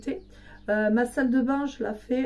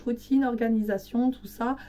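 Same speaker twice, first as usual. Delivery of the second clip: usual speaking voice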